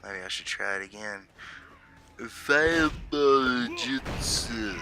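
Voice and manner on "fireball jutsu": slow theatrical villain menace, drawn out; Naruto villain voice. Pretend. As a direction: drag out the jutsu call like an anime villain. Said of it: "That's a little better".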